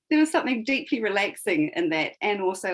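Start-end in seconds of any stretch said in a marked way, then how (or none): none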